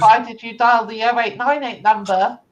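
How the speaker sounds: loud, high phone salesman; shop salesman voice. Acted. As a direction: bellow the shop greeting. GM plays shop phone answerer.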